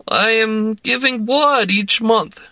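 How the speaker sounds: tipsy Russian woman urging a drink; Russian accent. A narrator's reading. Woman pressing vodka on narrator, in a Russian accent. Larissa voice.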